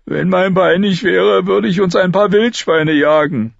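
put-on a hurt boy bragging; boastful Lommi. A narrator's reading. Lommi boasts about hunting boars, bragging.

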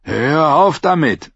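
leicht gespieltes drohendes Fauchen. A narrator's reading. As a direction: warn ihn, höher und langsam. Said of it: "fauchte der kleine Paul drohend". (Paul droht Lark, mild gespielt fauchend)

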